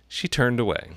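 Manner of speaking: usual speaking voice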